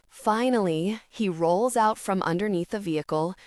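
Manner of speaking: ordinary delivery, nothing marked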